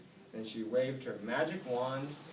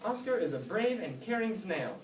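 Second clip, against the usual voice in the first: reporter; 9 semitones higher, 4 dB louder than usual. A narrator's reading. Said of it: "she reported".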